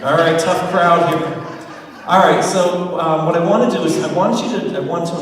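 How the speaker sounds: a big rallying call to a flat crowd. Real, not acted. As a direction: wake the audience up. Pumping up a quiet crowd.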